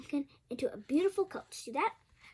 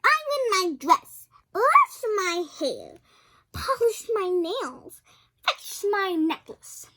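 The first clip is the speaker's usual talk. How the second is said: loud, high, slow string of orders; spoiled stepsister barking demands; bossy stepsister; 5 semitones higher, 11 dB louder, slower than usual. Acted. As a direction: boss her around, one chore at a time.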